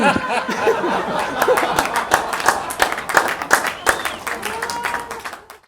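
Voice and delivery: long, high, breathy laughter. Real, not acted. Teller cracking up after his punchline. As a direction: squeal with laughter.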